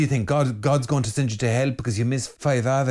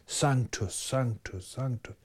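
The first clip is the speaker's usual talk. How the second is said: each holy softer, fading to a hush; soft repeated prayer; 7 dB quieter, slower than usual. Shown as a demonstration. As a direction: chant it gently, like the angels. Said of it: "Sanctus in Latin".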